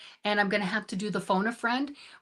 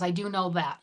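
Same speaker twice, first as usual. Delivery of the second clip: usual speaking voice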